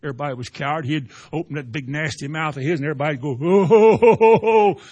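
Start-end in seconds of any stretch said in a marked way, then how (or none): 3.4-4.8 big booming mock laugh, much louder; mocking crowd laugh. Philistine crowd jeering at David, loud mocking laughter.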